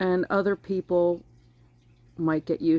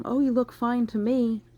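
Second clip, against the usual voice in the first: higher-pitched, breezy brush-off in imitation; dismissive reassurance; 6 semitones higher than usual. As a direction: mimic someone waving a problem away. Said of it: "That kind of comment".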